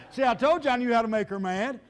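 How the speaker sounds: laughing. Real, not acted. Joke about making wife mad.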